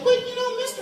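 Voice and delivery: put-on high squeaky kid protesting; little kid voice. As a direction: pipe up like a small child. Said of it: "Little kid's like".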